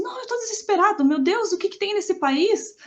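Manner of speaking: hammed-up terror from the man on the phone; mock desperate wail. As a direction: plead like a frightened child. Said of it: "desesperado no telefone".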